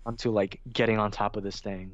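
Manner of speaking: ordinary talk, nothing marked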